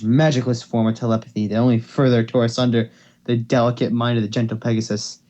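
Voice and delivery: ordinary delivery, nothing marked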